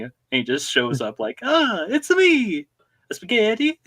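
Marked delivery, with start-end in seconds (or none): 1.4-3.8 very high, drawn-out Italian-accented cartoon voice; Mario. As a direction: squeal a jolly entrance with an Italian accent. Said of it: "I need Mario to show up".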